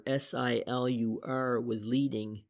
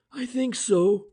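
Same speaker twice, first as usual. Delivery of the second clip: admitting; 11 semitones higher, 7 dB louder than usual. A narrator's reading. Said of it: "French admitted".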